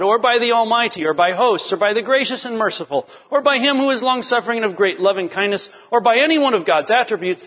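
high, quick, insistent run through the list; rapid list reading. Acted. Rattles off legalistic list from Mishnah.

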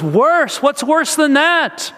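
can't believe it could be worse; alarmed disbelief. Retold or imitated. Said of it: "he's so concerned".

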